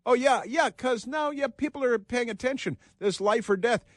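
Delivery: high, squeaky yeah-yeah parody of the senator; mock insincere agreement. Host mocking senator's answer to reporter, high sarcastic parody. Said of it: "insincerity dripping from his voice".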